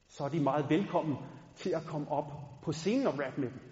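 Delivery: ordinary talk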